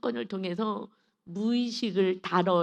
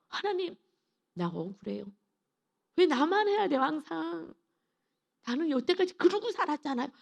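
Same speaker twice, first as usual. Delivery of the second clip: tearful complaint; 7 semitones higher than usual. Retold or imitated. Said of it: "막 울음이 막 터져 나온".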